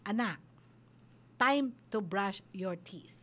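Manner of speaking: low, slow, measured parental instruction; calm firm voice. Shown as a demonstration. Parent face to face with child about brushing teeth, calm and firm. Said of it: "at firm voice".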